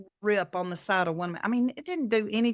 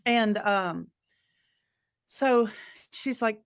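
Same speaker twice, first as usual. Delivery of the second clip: ordinary talk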